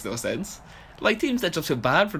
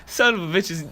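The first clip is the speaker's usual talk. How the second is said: ordinary delivery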